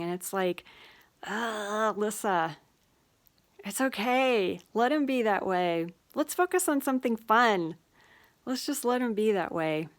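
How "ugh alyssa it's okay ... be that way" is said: higher and louder, coaxing herself to let it go; cheerful self pep talk. Retold or imitated. Talking to herself about an unkind person, cheerful pep talk, higher and louder. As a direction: brightly talk yourself out of the frustration.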